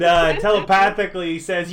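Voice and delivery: usual speaking voice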